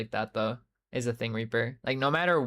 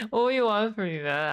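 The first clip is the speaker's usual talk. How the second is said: exasperated; 9 semitones higher than usual. Real, not acted. Exasperated at push-ups.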